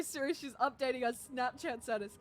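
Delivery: normal talking voice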